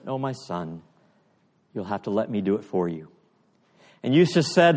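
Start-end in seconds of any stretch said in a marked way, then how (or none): 0.1-3.1 tender lion. Retold or imitated. The lion gently tells his weeping son he will help, lower and softer. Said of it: "the lion came forward and said".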